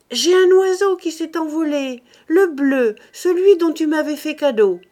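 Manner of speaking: put-on high, loud, upset child's cry. A narrator's reading. Little girl crying out to her brother about her lost bird, high and loud. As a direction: shout it out to her brother, near tears. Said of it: "en lui criant".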